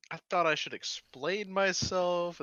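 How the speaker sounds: high, slow, drawn-out justifying; sheepish excuse. Acted. Clerk excusing himself to angry brewmaster, sheepish. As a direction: stretch out a reluctant explanation.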